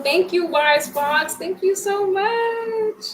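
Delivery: rising singsong gratitude; gushing thanks. Real, not acted. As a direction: stretch the thanks into a high grateful squeal. Thanks a generous viewer.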